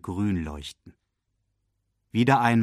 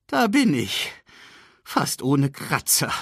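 high, lively cry, then slowing; animated old shaman figure; old baboon arriving; 5 semitones higher, 3 dB louder than usual. Acted. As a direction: announce yourself with a flourish. Old baboon announces his arrival.

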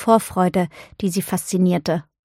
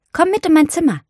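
high, louder, lively urging; eager invitation; 9 semitones higher, 5 dB louder, faster than usual. A narrator's reading. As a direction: invite a friend along eagerly. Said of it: "forderte Sarah sie auf". (lebhaft auffordernd)

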